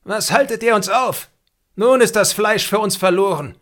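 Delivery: a chief screaming in rage; angry chief yells at the hunters. A narrator's reading. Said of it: "Der Häuptling schrie zornig".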